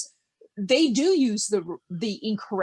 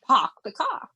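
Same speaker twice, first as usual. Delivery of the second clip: R-less Boston "park the car"; Bostonian accent; 3 semitones higher than usual. Shown as a demonstration. Writer showing a character's speech, Bostonian accent. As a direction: drop the Rs like a Bostonian. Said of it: "because that would be".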